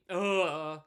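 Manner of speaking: loud, exasperated "ugh"; disgusted groan. Real, not acted. Streamer irked by a wrong name, loud disgusted groan. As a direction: groan loudly at the mistake.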